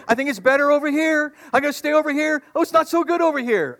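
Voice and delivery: high sheep voice, wavering between spots; fickle sheep. Acted. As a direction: sound like a sheep changing its mind.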